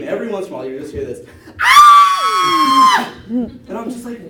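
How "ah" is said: piercing high shriek; child's scream. Retold or imitated. Kids in the schoolyard screaming, high shriek. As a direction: let out a sharp shrill scream. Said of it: "you just hear this, uh, this scream".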